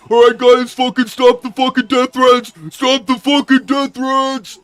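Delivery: high, loud, slow furious yell; angry shout. Retold or imitated. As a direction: bellow it like a fed-up boss. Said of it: "If Tony Khan says".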